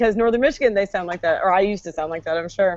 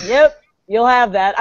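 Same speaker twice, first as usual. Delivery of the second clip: slow, unbothered country shrug; West Virginia ex-boyfriend; slower than usual. Retold or imitated. Ex reacting to terrible news, slow indifference. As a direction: deliver it slowly, like it's nothing. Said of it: "and he would say".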